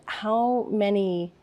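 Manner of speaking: usual speaking voice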